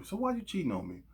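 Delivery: high, soft pleading question; meekly ask, parodying politeness; hammed-up gentle hurt; mock nice confrontation. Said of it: "say it to me in a nice way".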